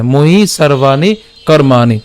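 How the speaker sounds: "ময়ি সর্বাণি কর্মাণি" slowly, long vowels drawn out; pronunciation demo. Shown as a demonstration. Teacher models "ময়ি সর্বাণি কর্মাণি" for learners, slow and measured. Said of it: "উচ্চারণ কেমন হচ্ছে".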